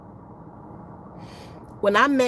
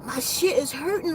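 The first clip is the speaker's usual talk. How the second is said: ordinary talk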